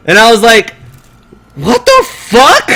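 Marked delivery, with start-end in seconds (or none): 1.6-2.7 slow, high-pitched bafflement; hammed-up stunned disbelief; incredulous. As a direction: stretch it out, voice shooting up. Retelling his reaction to the insult.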